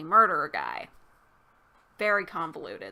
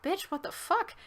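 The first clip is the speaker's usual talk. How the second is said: pitched up high in disbelief; incredulous exasperation; 9 semitones higher than usual. Retold or imitated. Reviewer mocking the clueless heroine, high incredulous. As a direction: toss it off as stunned, exasperated disbelief. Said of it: "And I'm like".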